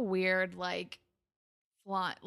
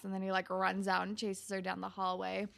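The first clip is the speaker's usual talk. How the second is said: usual speaking voice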